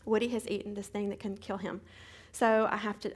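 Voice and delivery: usual speaking voice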